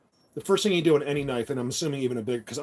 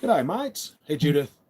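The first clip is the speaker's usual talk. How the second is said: attempted Australian accent; slower than usual. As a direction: try a cheery hello in an amateur Australian accent. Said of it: "do an Australian accent".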